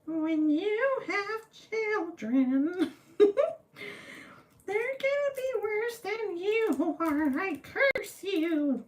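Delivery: high, slow witchy cackling voice; hammed-up shrill witch putting a curse on someone; witch's curse. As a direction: draw out a curse like a storybook witch. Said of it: "I didn't know you were a witch".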